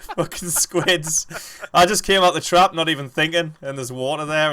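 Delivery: high, loud, rattled outburst; worked-up after a scare. Real, not acted. Gamer telling friends of the squid ambush, rattled.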